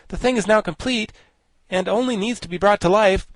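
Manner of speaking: high, loud admiration; admiring. A narrator's reading. Wogglebug admires the finished flying Thing, high-voiced admiration. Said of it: "with considerable admiration".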